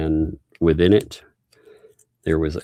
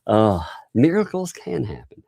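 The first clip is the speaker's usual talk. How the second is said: ordinary talk, nothing marked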